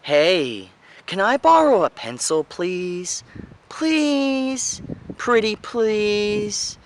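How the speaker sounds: high, stretched-out pleading, pitched right up; trying for rapport. Shown as a demonstration. Coach demonstrating trying for rapport, upward pleading tone. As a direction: beg sweetly upward, drawing out the words. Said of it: "trying for rapport, which goes up".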